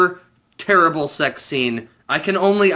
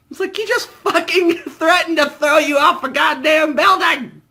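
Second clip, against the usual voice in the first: much higher, loud shout with a laugh; laughing outrage; 12 semitones higher, 3 dB louder than usual. Real, not acted. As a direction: yell it in amused disbelief. Ranting about the scene.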